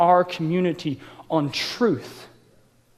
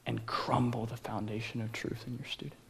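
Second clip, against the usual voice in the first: hushed earnest; 6 semitones lower, 16 dB quieter than usual. Real, not acted. Solemn point about biblical community.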